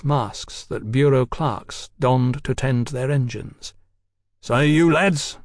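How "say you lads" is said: mildly barked challenge, slightly raised. Acted. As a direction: bark it out, with restraint. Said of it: "Fraser barked".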